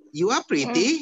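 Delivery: "You are pretty" high and loud with a rising end; questioning statement. Shown as a demonstration. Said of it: "di belakang kalimat ada question mark".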